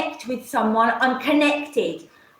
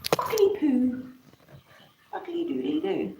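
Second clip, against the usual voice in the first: much softer, muttered under the breath; muttered to herself; 3 semitones lower, 9 dB quieter than usual. Real, not acted. Alone on her live stream, muttering much softer. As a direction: grumble it quietly to yourself.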